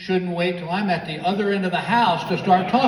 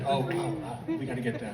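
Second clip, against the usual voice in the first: quiet running to-do list mumbled under the breath; wife thinking aloud while he drives; Gail muttering to herself; 5 semitones lower, 8 dB quieter than usual. Retold or imitated. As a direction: ramble the chores softly, thinking aloud. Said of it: "So I hear this".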